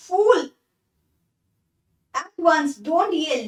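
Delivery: a father losing patience, snapping one word; angry father. Acted. Father snaps at his daughter. (बेटी पर ऊँची, तेज़ झिड़की)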